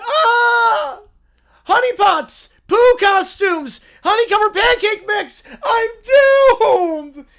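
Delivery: high, loud, wailing cub; mock-terrified little bear wail; hammed-up panic screams. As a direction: scream each item like the world ends.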